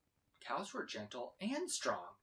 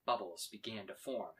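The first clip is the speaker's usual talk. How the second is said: ordinary delivery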